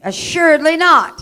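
loud, ringing declaration for the church to echo; emphatic call to repeat. Shown as a demonstration. Preacher leading the church in a loud declaration. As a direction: lead the congregation, each word hammered. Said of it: "say this with me".

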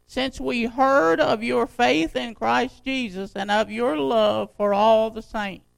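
slow, emphatic pulpit reading; scripture reading. Acted. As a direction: proclaim the verse, landing hard on key words. Reads the letter aloud to congregation.

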